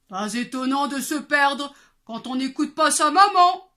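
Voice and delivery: loud grumbling papa bear; big bear growling. Acted. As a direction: roar it like a big angry bear. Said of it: "gronda le grand ours de sa grande voix".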